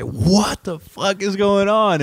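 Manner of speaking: high, slow, drawn-out what the fuck; stunned disbelief. Retold or imitated. Seeing cops swarm the party, slow stunned outburst. Retold shock at helicopter scene.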